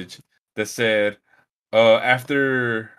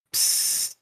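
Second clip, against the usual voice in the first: an unvoiced psst, like a leaking pipe; hissing psst sound; 7 dB quieter than usual. Mimicking the leak noise heard from the water heater, hissing psst. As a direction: hiss it out like water spraying from a pipe. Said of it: "a psst sound".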